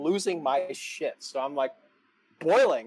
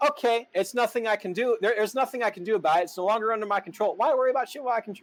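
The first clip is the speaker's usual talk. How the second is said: normal talking voice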